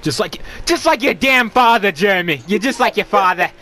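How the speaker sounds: dramatic soap-opera scolding. Pretend. Mock soap-opera parent scolding.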